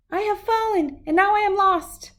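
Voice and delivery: high plaintive little voice; high lost little dinosaur. Acted. Little T-Rex lost in the woods, high and plaintive. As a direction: sound small and lost.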